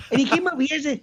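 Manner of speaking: usual speaking voice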